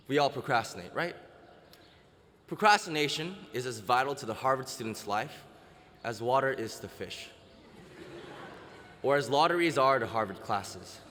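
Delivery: ordinary talk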